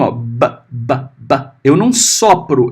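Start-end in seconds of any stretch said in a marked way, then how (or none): 0.0-1.4 b, b, b as short soft pops, lips closed; teacher demoing a plosive b. Shown as a demonstration. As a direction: pop the b like a little click. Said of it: "beth é um estalinho".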